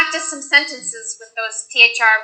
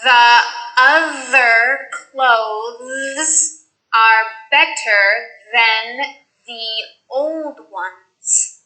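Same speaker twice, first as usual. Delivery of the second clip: slow th practice; much slower than usual. Shown as a demonstration. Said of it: "Here are some sentences with the mixed sounds".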